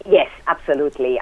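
normal talking voice

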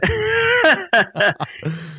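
shrill burst of laughter; sudden high-pitched cackle; high squealing laugh. Real, not acted.

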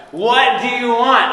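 loud, high movie-scene yell; imitated full-force scream; screaming demand. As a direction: shout it in exasperation. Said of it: "he screams at Rachel McAdams".

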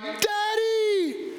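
put-on high child's cry of Daddy; kids calling Daddy. Kids crying out for dad at night, high childlike call. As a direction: call out like a scared little kid. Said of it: "they're like".

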